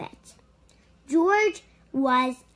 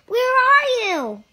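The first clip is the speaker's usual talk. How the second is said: loud high call; 12 semitones higher, 6 dB louder than usual. A narrator's reading. Bill calls for the missing monkey, loud high shout. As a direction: call for him across the lake. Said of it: "he called".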